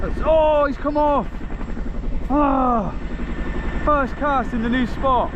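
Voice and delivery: high, loud groan of frustration; dismayed cry. Real, not acted. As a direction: cry out as the fish gets away. Loses the hooked fish.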